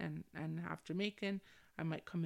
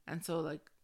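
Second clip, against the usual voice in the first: ordinary talk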